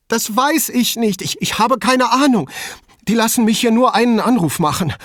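high, loud, stammering distress; panicked. A narrator's reading. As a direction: plead helplessly, pitch far up. Distraught caller in shock. (panisch und aufgelöst)

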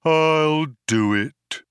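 slow tolling, like a cracked bell; high, droning robot resignation; Marvin. A narrator's reading. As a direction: intone each word long and dolefully. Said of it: "like the tolling of a great cracked bell".

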